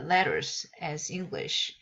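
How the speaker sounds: ordinary delivery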